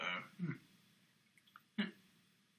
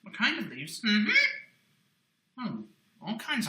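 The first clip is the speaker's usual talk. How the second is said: ordinary talk, nothing marked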